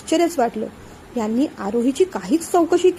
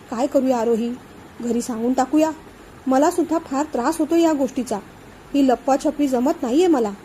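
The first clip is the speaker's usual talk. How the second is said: ordinary delivery, nothing marked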